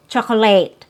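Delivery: "chocolate" as CHAH-kuh-layt; different pronunciation. Shown as a demonstration. Teacher listing versions natives don't use, "chocolate" as CHAH-kuh-layt. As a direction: end "chocolate" on a full "late". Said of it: "that's a different type of pronunciation".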